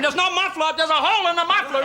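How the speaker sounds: high, agitated yell as the father; high-pitched comic yelling; screaming father. Retold or imitated. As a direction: imitate an outraged dad yelling. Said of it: "my father starts screaming".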